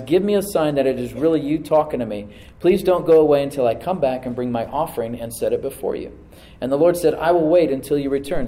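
loud, ringing scripture reading; full-voiced preacher reading; preaching scripture. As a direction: proclaim the text to the congregation.

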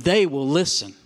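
"they" hit loud and drawn out; emphatic "they", then ease through the rest; stress on THEY. Acted. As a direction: read it slowly, leaning hard on "they". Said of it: "with the emphasis on the word they".

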